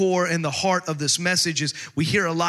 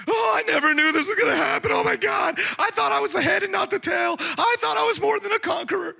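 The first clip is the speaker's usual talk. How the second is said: hammed-up self-pitying complaint, pitched way up; woe is me whiner; 13 semitones higher than usual. Preacher mocking a self-pitying believer, high mock wail. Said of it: "So don't become woe is me".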